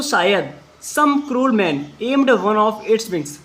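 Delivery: very slow, word by word English; slow dictation. Shown as a demonstration. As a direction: spell it out slowly for the class. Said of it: "repeats sentence for class". (धीमे-धीमे बोलकर लिखवाना)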